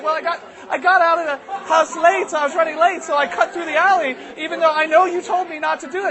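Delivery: put-on high, loud little-boy pleading; upset child. Boy explains himself to mom, upset. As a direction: blurt excuses in a distressed kid voice. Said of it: "and he's just crying".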